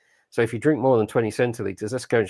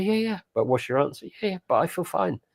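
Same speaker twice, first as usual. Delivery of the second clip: higher-pitched mock dialogue; imitated pleading, higher voice; quoted back-and-forth; 5 semitones higher than usual. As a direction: lightly act out the exchange, pitched up. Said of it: "And you say".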